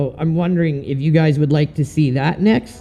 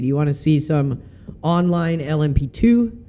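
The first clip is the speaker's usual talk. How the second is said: ordinary delivery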